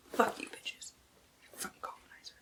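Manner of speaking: hushed, unvoiced muttered curse; whispered curse. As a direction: spit it under your breath. Aside cursing villains in blurb.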